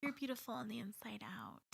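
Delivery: soft whispered compliment; whispering. Real, not acted. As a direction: whisper it kindly.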